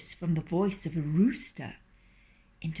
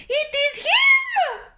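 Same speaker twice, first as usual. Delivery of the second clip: very high, loud shriek; screaming hen; 8 dB louder, slower than usual. A narrator's reading. Hen sees the fox take the rooster, shrieking. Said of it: "screamed Partlet".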